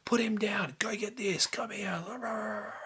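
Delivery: high, hurried half-whispered orders; urgent commands. Retold or imitated. Husband ordering Mum around in an emergency, high urgent commands. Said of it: "giving commands".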